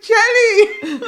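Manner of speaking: high, loud shout of a name; imitated calling out. Sister calling across the pool, imitated shout. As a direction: holler it like the exasperated sister. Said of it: "hear her calling my name".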